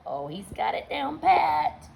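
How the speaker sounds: usual speaking voice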